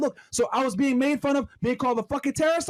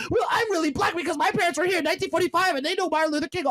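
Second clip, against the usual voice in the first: higher, shouted mocking voice; mock Twitter critic; 5 semitones higher than usual.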